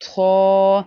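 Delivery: थो with mouth wide open, heavy; open-mouth thick tho. Shown as a demonstration. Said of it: "मुंह खोल कर पढ़ेंगे". (मुंह खोल कर भारी थो)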